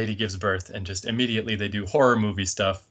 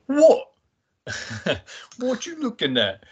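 mock stroppy British girl; 13 semitones higher than usual. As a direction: snap it like a touchy teen who feels stared at. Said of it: "they go".

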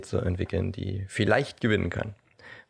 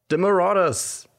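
high, ringing award-show announcement; triumphant winner announcement; 10 semitones higher, 4 dB louder than usual. Pretend. Host reveals the pitch winner, triumphant announcement. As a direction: proclaim the winner with a swelling pitch.

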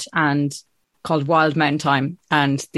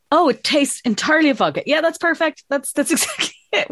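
higher, delighted mock-innocent voice; younger self; 9 semitones higher than usual. Guest mimics her younger self loving a strong vodka, higher and gleeful. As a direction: play your teenage self, thrilled with the strong drink. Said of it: "and you're like".

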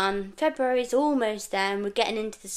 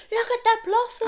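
high-pitched, squealing mock call-out; gleeful exclamation, pitched right up; retold excited shout; 13 semitones higher, 3 dB louder than usual. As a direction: re-enact calling out in delight from the van. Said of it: "I did shout out in the van".

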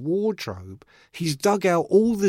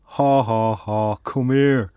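slow, knowing put-on chuckle; 4 semitones lower, 3 dB louder, slower than usual. Rock star beckoning young fan, slow knowing laugh. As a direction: beckon with a drawn-out ha-ha.

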